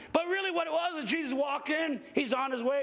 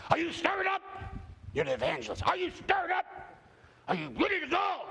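eager pastor; 5 semitones higher than usual. Retold or imitated. Pastors pressing the evangelist.

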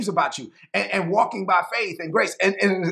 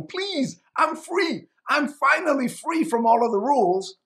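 high-pitched, urgent plea; acted-out begging; 6 semitones higher than usual. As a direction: plead with them, voice lifted high. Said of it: "And he's begging people".

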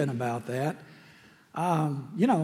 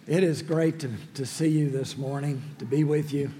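ordinary talk

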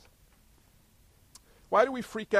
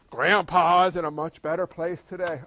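mock condolence; 4 semitones lower, 7 dB louder than usual. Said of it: "funeral for your non-believer friends".

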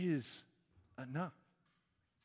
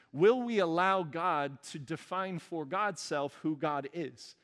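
normal talking voice

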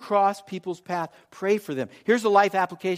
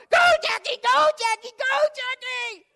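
very high, shrieked sideline cheer; mimicked top-of-lungs yelling. Mother and grandmother at the grandson's football game, yelling. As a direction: scream it like mom and grandma cheering. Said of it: "yelling at the top of their lungs".

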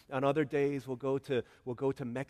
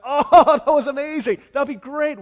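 high, loud, laughing wonder; amazed laughing cry; 20 dB louder than usual. Pretend. Pretending to be saved from the lion, laughing amazement. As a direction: gasp it out in delight. Imagined awe after lion walks away.

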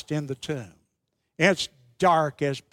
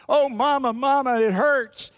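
higher-pitched mock wail; retold crying child; 6 semitones higher than usual. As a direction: imitate a hurt little boy calling for his mother, half-acted. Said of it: "little boy screaming and crying".

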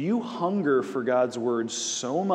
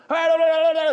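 much higher and louder mock yell; imitated yelling mechanic; 8 dB louder, faster than usual. Mechanic in the maintenance bay yelling for the others, high mock shout. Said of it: "I hear this one guy going".

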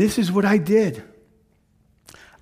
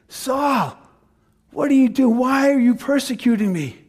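voice of Jesus; 5 semitones higher than usual. Acted. Jesus calling to Saul on the Damascus road, raised voice. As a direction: call out to Saul with urgency.

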